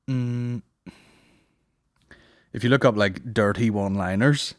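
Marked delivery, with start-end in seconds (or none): none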